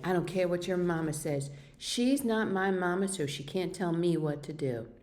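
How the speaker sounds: usual speaking voice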